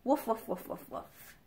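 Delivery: light mock barking fading to a whisper; playful dog barks. Reader playing Rusty the dog, playful barks. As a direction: bark like the puppy.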